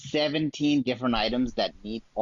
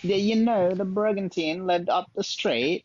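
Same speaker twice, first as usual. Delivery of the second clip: high-pitched, hammed-up British accent; bad British accent; 7 semitones higher than usual. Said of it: "your accent is absolutely horrible".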